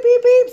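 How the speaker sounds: high, loud imitated security alarm beep. Imitating the shop alarm, high beeping. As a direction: mimic the door sensor going off. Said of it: "went beep".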